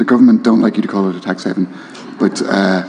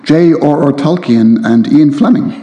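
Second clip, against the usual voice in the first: lively reading voice, a little higher; reading aloud; 3 semitones higher than usual. A narrator's reading. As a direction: read the novel with relish to the crowd. Author reads novel excerpt aloud.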